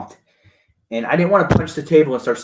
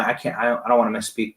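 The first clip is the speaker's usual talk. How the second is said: usual speaking voice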